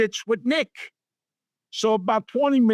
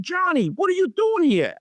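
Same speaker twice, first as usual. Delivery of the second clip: high, surprised call of his name; a friend's surprised hello at a bar; Ellen, surprised to see him at the bar, calls out high; surprised greeting; 11 semitones higher than usual. Retold or imitated.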